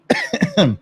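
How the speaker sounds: short cough, clearing the throat; a small choking cough. Real, not acted. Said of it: "choking on my own spit".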